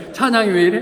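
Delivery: loud, high mock complaint; complaining. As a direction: act out an indignant churchgoer griping. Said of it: "누가 불평한 사람이".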